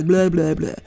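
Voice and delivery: loose blah blah warm-up; tongue exercise. Shown as a demonstration. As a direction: flap the tongue through blah blah. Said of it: "like a tongue exercise".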